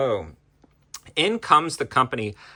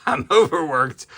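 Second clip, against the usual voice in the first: laughing; 2 semitones higher, 5 dB louder than usual. Real, not acted. Laughs at own exhaustion.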